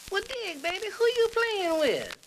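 very high, drawn-out hipster voice; hip jazz musician. Acted. Musician pressing his friend, hip jazz cat. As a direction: push it way up and stretch it. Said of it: "the conversation went like this".

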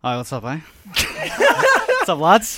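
mock British lad greeting the hosts; a put-on English accent, laughing. Fake British comedian greets radio hosts in a British accent. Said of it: "Wow, that's a perfect accent".

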